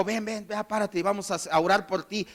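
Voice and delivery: soft, discreet murmured offer. Acted. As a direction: say it quietly, as a private offer. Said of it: "No nada más llegó y le dijo".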